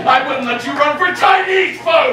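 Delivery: high, heated put-down; outraged jeer; scornful shout. Acted. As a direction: hurl the insult loudly. Insult in a quarrel.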